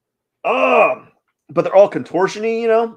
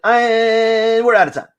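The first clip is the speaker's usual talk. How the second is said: mock announcement; 6 semitones higher, 4 dB louder than usual. Telling the stream viewers time's up, loud and mock-dramatic. Fake timer ending again.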